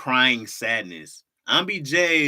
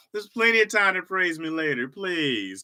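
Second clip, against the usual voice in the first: mock-modest; 7 semitones higher than usual. Continues coy act about praise.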